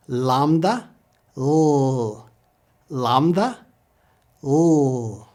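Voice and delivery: "Lambda", then "L" as a long held "lll"; English L sound. Shown as a demonstration. Teacher leads repetition, held L sound. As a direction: alternate "LAHM-tha" with a sustained L. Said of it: "just like our L".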